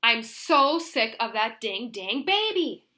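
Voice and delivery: loud, slow, fed-up outburst. Acted. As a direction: blurt it out so the whole table hears. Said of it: "she said it again".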